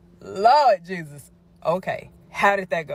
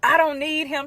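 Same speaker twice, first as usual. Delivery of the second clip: upset friend; 9 semitones higher than usual. Retold or imitated. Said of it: "She starts saying".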